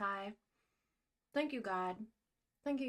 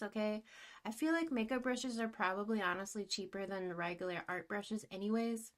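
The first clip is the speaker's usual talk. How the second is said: normal talking voice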